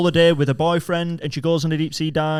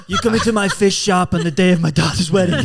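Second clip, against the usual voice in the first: a light mock Godfather, laughing; Codfather impression; 2 semitones higher, 4 dB louder than usual. The dad as Codfather, mock mafia boss. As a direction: gangster chip-shop owner, half laughing. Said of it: "Trying to be Don Corleone".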